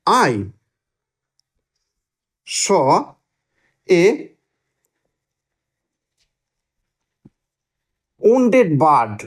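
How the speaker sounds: one word at a time, long gaps between; slow dictation. Shown as a demonstration. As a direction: dictate slowly at writing speed, slightly low-pitched. Teacher dictates example sentence while writing. (লিখতে লিখতে এক একটি শব্দ থেমে থেমে বলা)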